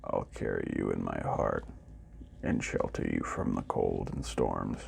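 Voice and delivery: deep, quiet, forlorn robot; sad robot. A narrator's reading. Robot speaks in the story.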